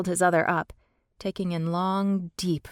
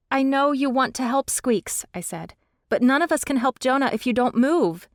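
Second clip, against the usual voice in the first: urgent pleading; 6 semitones higher, 4 dB louder than usual. A narrator's reading. Urgent plea to creature.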